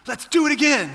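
put-on high, loud, thrilled little boy; excited kid. Son begging dad to ride again, excited. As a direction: burst out like an eager nine-year-old. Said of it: "he was so excited".

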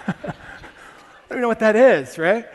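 laughing loud, high and slow; loud real laugh through the words. Real, not acted. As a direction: crack up as you say it. Laughing at his own story.

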